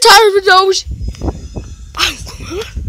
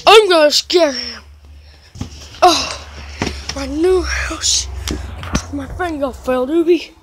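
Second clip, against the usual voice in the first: usual speaking voice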